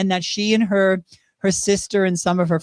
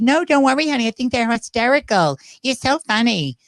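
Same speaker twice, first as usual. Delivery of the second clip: higher, sweet [name] reassuring; [name] impression; 4 semitones higher than usual. [name] reassuring him about his videos, higher sweet impression. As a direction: purr it like [name] brushing off the apology. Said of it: "that's my [name] impersonation".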